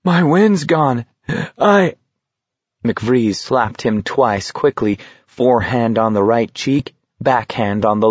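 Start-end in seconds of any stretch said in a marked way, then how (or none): 0.0-1.9 high, winded and halting; gasping. A narrator's reading. Garrity tells McVries his wind is gone, gasping. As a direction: squeeze it out slowly with no breath left. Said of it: "Garrity gasped".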